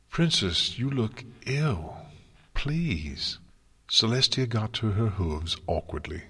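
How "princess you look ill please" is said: higher, drawn-out concerned appeal; worried pleading. A narrator's reading. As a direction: plead gently with someone who looks unwell. Rarity worries over the ailing princess.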